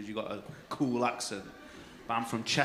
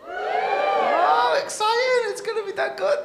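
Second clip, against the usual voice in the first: mock excitement; 11 dB louder, slower than usual. Real, not acted.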